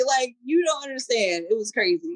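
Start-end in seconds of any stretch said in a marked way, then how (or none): none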